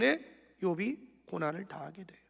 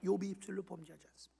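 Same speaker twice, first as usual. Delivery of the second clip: softer, quicker 욥이 입술로 범죄하지 않습니다 with a falling end; quiet fast restatement; 2 semitones lower, 7 dB quieter, faster than usual. Shown as a demonstration. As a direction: drop to a brisk, soft recap. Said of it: "이 모든 일에 욥이 입술로 범죄하지 않더라".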